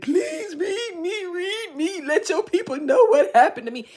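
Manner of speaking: imitating a caller crying for a reading; mock begging. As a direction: beg like a desperate fan, hammed-up. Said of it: "just beg".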